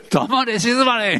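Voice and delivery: 「黙れ、静まれ」 said slowly through a laugh; mock the stern command while chuckling; laughing rebuke. (笑いながら「黙れ、静まれ」)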